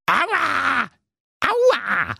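high squeaky cries from the sack; pained ouch. A narrator's reading. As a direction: yelp at every step. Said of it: "Bei jeder Stufe sprach der Sack".

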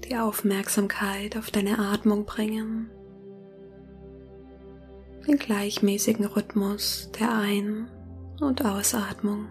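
soft spa whisper. Acted. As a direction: whisper gently, guiding them into calm. Leitet eine Meditation. (sanftes Meditationsflüstern)

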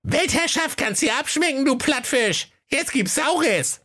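mock the wizard like tiny dwarves; dwarves taunting. A narrator's reading. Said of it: "riefen sie". (hohe, spöttische Zwergenstimme)

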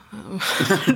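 short laugh. Real, not acted. Laugh after quote.

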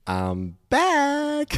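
hoher, gesungener Ausruf; triumphierende Rückkehr. Real, not acted. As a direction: wie ein Held zurückmelden. Kehrt nach Ausfall zurück. (triumphierend angekündigt)

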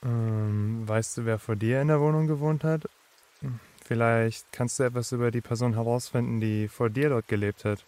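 calm, deep-voiced reassurance; calm male character. A narrator's reading. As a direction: gently suggest it in a deep, unruffled voice. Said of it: "meinte er ganz ruhig".

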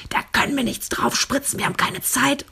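high, strained mock yell; angry yelling. Doctor to anaesthetist with no time left, angry yelling. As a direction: imitate a doctor yelling in a panic. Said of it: "Und dann schreit er die an".

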